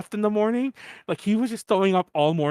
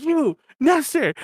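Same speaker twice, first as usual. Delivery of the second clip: higher, slow, accusing call; mock yelling; 9 semitones higher, 3 dB louder, slower than usual. Drunk host blaming Nestor, slow mock yell. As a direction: point him out with a mock yell. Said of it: "I was yelling at everyone".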